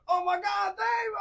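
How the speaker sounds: very high, loud shriek of his name; screaming. Retold or imitated. Girl on the phone recognizing Dave, screaming very high. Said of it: "she's screaming".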